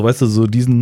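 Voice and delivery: normal talking voice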